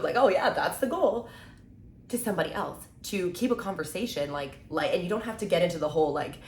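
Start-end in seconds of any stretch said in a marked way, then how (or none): 0.2-1.3 higher, louder, slow and breezy; playing it off as a joke. Shown as a demonstration. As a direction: toss it off like a light joke. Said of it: "play it off almost as a joke".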